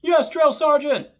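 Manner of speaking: high, loud, slow sound-off; recruit sound-off. Retold or imitated. Recruit answers drill sergeant, high loud sound-off. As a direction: snap back at full volume.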